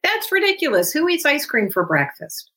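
put-on high, incredulous scoffing at the child; mock the request as absurd; dismissive scoff. Shown as a demonstration. Parent scoffing at child's ice cream request, incredulous. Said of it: "you don't have the luxury of saying to your child".

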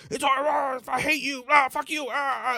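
high, strained yells mid-wrestle; angry fight yelling. Retold or imitated. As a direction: scream it like you're grappling your brother. Sibling fistfight, yelling at brother.

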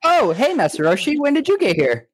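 mock Goku. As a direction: squeak it out like a surprised cartoon hero. Goku voiced greeting Roshi.

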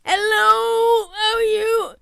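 nervous fan greeting with stomach held in; cheeks sucked in. Retold or imitated. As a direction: say hello while holding your breath and stomach in. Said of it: "held my cheeks and held the stomach in".

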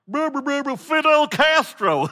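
high, loud mocking tourist voice; loud dopey American. Preacher mocks loud tourists in Cuba, high and loud. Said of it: "big, loud, dopey Americans are like".

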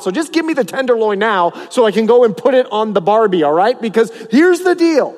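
entitled priests' sons, loud and bossy; brash demanding boys. Acted. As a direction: act out the spoiled sons demanding. The boys demanding barbecue meat.

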